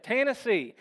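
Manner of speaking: involuntary mock Tennessee accent; mimicked Tennessee drawl. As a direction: blurt out Tennessee the way a Southerner would, without meaning to. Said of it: "repeating what he said ... exactly the way he said it".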